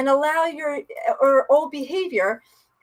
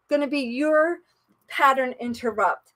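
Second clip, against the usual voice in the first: ordinary delivery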